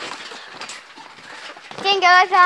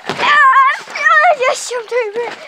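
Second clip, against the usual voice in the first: excited shout; 6 semitones higher, slower than usual. Real, not acted. Kids bouncing on trampoline.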